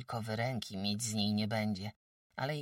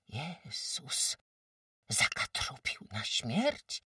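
shocked gasp; 3 semitones higher, much slower than usual. Acted. Agata shocked.

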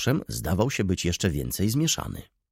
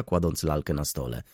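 plain reading voice

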